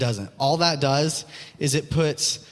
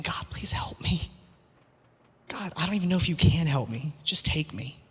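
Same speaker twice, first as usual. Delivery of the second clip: broken whispered plea to God, softer; imitating sobbing whisper; 5 dB quieter than usual. Despairing Elijah whispers his plea to God, sobbing softly. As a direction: whisper it like a man at the end of himself. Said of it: "I can just imagine Elijah just sobbing".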